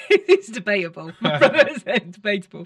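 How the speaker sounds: laughing loudly through words. Real, not acted. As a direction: laugh while you talk. Laughing about her age.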